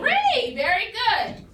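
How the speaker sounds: high, loud echo of the right answer; loud, delighted teacher approval; excited praise. Real, not acted. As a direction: cheer the class's answer, pitch leaping up.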